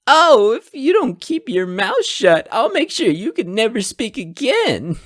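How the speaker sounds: high, loud mocking imitation of his threat; mock villain threat. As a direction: parrot his menace back in a sneering falsetto.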